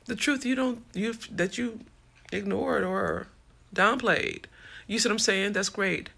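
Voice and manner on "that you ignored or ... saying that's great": usual speaking voice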